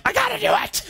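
high, laughing squeal. Retold or imitated. Preacher laughing as he gives in to the chant. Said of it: "Me, I'm like".

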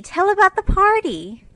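put-on high, loud childlike plea rising at the end; eager little girls again. A narrator's reading. Little girls in nightcaps plead to hear about the party, rising eager plea. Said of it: "two sleepy but eager voices cried out".

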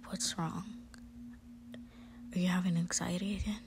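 put-on pleading boyfriend whisper, soft and close; whispered reassurance to a frightened girlfriend; soft whispered comfort. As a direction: coax her to speak, gently whispering. Boyfriend character soothing panicking listener.

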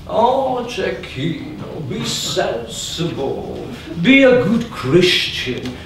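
slow, drawn-out priestly sermonizing; preachy priest. Acted. The priest pleads with Cecchino: slow sermonizing priest. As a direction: lecture him slowly like a pious priest. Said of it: "the priest came".

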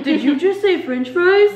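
eager high mock voice; hammed-up excited brother; imitating her brother. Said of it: "Storms out of his room".

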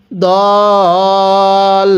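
দল with the vowel held four alif counts; long madd on dal. Shown as a demonstration. Teacher showing students দল with a four-count pull. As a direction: stretch the vowel of দল long and steady. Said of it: "দদের মধ্যে চার আলিফ টান দিব".

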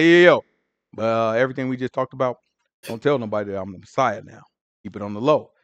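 low, quick, hushed and casual; hushed Jesus paraphrase. Retold or imitated. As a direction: keep it secret, just between us. Jesus quietly swearing disciples to secrecy.

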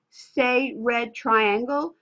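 slow, clearly spelled-out teacher prompt; slow drill prompt. Shown as a demonstration. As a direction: say it slowly for a child to copy. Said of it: "I would hold it up at eye level and say".

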